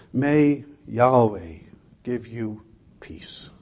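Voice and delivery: slow, breathy whisper of a blessing; a whispered benediction over the congregation; hushed blessing. Acted. Pronouncing the benediction softly.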